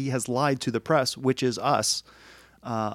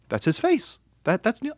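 higher, startled whisper-gasp recognition; surprised realization; 10 semitones higher than usual. Retold or imitated.

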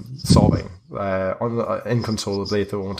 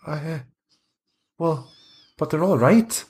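higher voice, uneasily insisting; a hint of a worried boy; lightly voiced anxious boy; 6 semitones higher than usual. Boy insists the bones protect them, anxious.

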